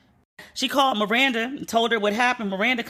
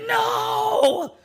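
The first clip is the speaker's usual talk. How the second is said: much higher, piercing shouted no; a high, piercing yell to stop him; 13 semitones higher than usual. Retold or imitated. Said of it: "stood in the way and was like".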